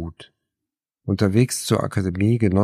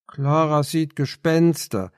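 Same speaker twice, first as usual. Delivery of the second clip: slow, higher teasing; mocking; 7 semitones higher than usual. A narrator's reading. Anne mocking Clara to Katharina, teasing. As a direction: poke fun at her friend. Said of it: "spottete Anne".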